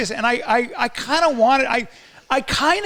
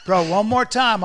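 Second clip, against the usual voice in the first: loud playful challenge; mock tough warning; 5 dB louder than usual. Tough guy warning a bro, loud mock threat. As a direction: warn him off loudly, play-acting. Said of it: "I wanna just go like this".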